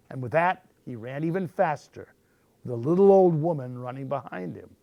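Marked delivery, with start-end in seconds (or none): none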